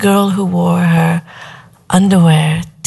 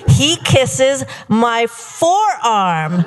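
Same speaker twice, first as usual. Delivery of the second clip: building swoon; 6 semitones higher than usual. Acted. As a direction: stretch it out, rising into mock rapture. Retelling escalating stage kisses, building rapture.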